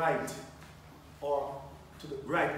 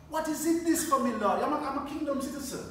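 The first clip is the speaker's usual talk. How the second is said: raised, high-pitched cry to the Lord; impassioned plea to God; 6 semitones higher, 4 dB louder than usual. Acted. Believer asking God why he is in Holland, impassioned plea. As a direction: demand an answer from God, hitting the questions loud.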